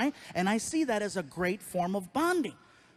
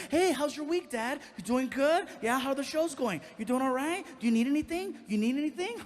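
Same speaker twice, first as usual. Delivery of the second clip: higher-pitched, over-eager chattiness; fake chatty; 5 semitones higher than usual. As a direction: imitate a kid suddenly buttering up Dad. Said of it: "from one-word kid to very like".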